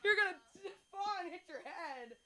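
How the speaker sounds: high, loud, fretting mother. Retold or imitated. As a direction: scold like a frantic mom. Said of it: "Oh, I'm my mother".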